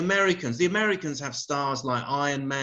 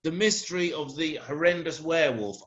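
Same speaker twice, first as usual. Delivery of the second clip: ordinary talk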